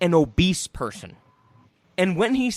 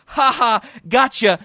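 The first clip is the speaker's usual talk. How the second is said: gloating; 6 semitones higher, 11 dB louder than usual. Retold or imitated. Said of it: "The obese man goes".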